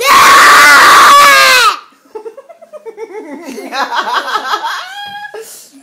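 loud, high T-Rex roar; dinosaur voice. Pretend. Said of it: "Now make a T-Rex noise".

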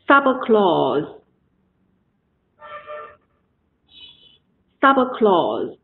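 "sub clause" stressed on "sub", falling through "clause"; stress in front. Shown as a demonstration. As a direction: model "sub clause" for repeating, weight on "sub". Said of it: "在这个单词里面就不能拖那么长了". (sub clause：前面重读，后面不突出)

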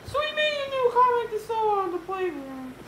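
very high, loud character voice; George explaining. Acted. George explaining to Yesterday George, high and loud.

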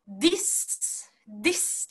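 "this" as "dis" with flowing long s; breath passed onward. Shown as a demonstration. Teacher modelling the correct release, "this" with a lengthened s. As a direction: send air from the d into the hiss of "this". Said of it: "次に息を送るような感じ".

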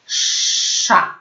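"sza" with a long hushing sz before the vowel; drawn-out SZ. Shown as a demonstration. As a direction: model the syllable "sza", sz held long. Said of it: "Pamiętamy język wysoko".

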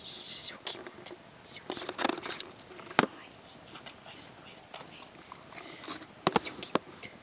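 soft whisper over a cute dog; adoring, barely voiced whisper; whispered cooing. Real, not acted. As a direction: coo it under your breath.

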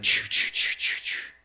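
whispered chew chew chew, imitating frantic chewing; unvoiced chew chew chew; mock chewing noises. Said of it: "So Martha's like".

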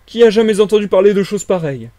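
high, loud disbelieving question; incredulous exclamation. A narrator's reading. Villager hearing of an invisible man, incredulous exclamation.

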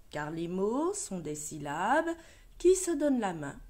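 ordinary talk

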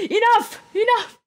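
high, loud repeated outcry; exasperated shout. Real, not acted. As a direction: call a halt, high and loud. Calls a halt.

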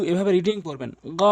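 usual speaking voice

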